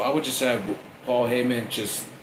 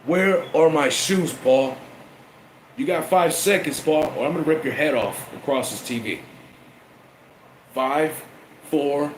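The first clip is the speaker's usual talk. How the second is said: louder mock threat with countdown; threatening Roman; 4 semitones higher, 3 dB louder than usual.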